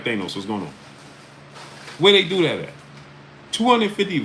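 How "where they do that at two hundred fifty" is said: much higher and louder exclamation; indignant at his own chat; outraged disbelief. Real, not acted. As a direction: throw the number out high and loud. Host exclaims at like count.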